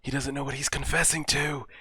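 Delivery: high, pained exclamation; agonised at someone's blunder; distressed disbelief. Real, not acted. Distressed at character's mistaken confession.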